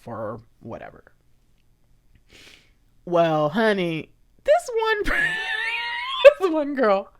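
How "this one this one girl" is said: high laughing squeal. Real, not acted. Starting a juicy story for viewers, laughing. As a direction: laugh through the words.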